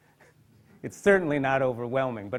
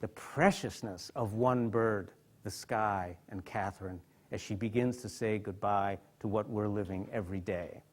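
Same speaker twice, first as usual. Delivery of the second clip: moved, hushed; 6 semitones lower, 8 dB quieter than usual. Real, not acted. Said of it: "I feel so emotional just saying those words".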